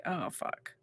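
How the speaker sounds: low, soft aside to oneself; muted retold dread. Grandchild reacting to bad family news, quiet retold dread. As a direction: drop it low and quiet, reluctantly.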